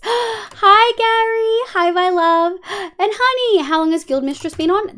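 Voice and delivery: high, loud delighted hello; light up as your love walks in; excited greeting. Real, not acted. Streamer greets partner Gary arriving, gasping high and loud.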